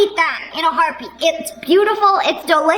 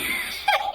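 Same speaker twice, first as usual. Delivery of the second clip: short burst of laughter; 8 dB quieter than usual. Real, not acted. Laughs at the Ross cake.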